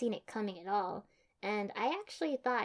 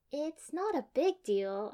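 higher, drawn-out, casual reassurance; light; 6 semitones higher, 3 dB louder, slower than usual. A narrator's reading. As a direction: brush it off lightly. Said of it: "I said lightly".

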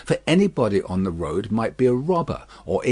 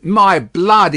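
loud, high burst of annoyance; irritated coachman's outburst; vexed exclamation; 7 semitones higher, 8 dB louder than usual. A narrator's reading. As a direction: exclaim it in exasperation. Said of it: "ejaculated the vexed coachman".

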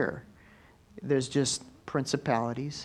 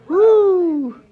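joyful shout; 12 dB louder than usual. Real, not acted. Preacher rejoicing after praise.

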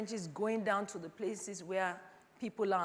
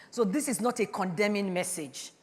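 ordinary delivery

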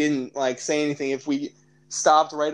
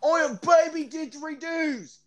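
high-pitched, hammed-up Australian accent; 11 semitones higher than usual. Joking plea to podcast listeners, exaggerated Australian accent. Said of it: "I'm gonna do an Australian accent".

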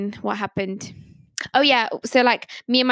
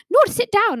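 higher, firm order from the teacher; bossy teacher; 10 semitones higher, 3 dB louder than usual. Retold or imitated. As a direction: snap back as the impatient teacher. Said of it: "then she was like".

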